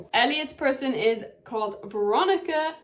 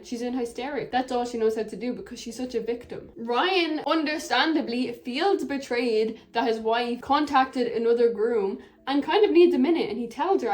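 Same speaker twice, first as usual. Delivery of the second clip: normal talking voice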